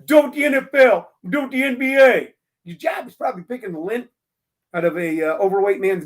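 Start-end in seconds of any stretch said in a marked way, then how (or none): 0.0-2.3 mocking ranting critic. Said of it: "people constantly going".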